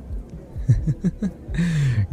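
soft, high giggle; light run of quiet laughter; pleased giggle. Acted. Pleased that invitation is accepted.

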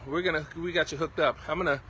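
ordinary talk, nothing marked

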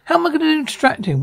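high, quick incredulous question; fast, high-pitched disbelief; incredulous protest. Acted. Balking at the escape plan.

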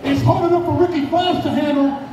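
ordinary talk, nothing marked

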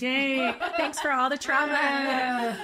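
slow, laughing sarcasm. Real, not acted. Thanking the birth father for trauma, laughing. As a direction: laugh through the mock thanks.

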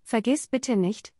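ordinary delivery

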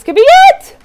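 very high, loud squeal; real excited outburst; thrilled anticipation. Real, not acted. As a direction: shriek it with rising pitch. Collection nearly complete, excited squeal.

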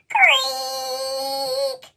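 plain reading voice

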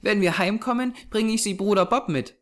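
put-on high child's voice, artless; happy little girl. A narrator's reading. Small girl planning a present for her brother, innocently happy. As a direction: plan happily in a small girl's high voice.

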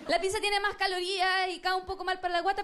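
ordinary delivery

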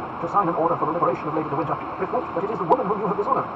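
insistent plea, building in pitch; earnest entreaty. Acted. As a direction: plead firmly for her liberty. Felton entreats the Duke.

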